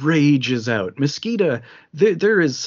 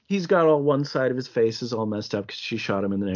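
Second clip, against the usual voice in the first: ordinary delivery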